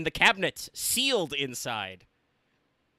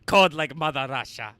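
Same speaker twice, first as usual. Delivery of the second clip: loud mock Russian; 8 dB louder than usual. Mocking Russian bravado.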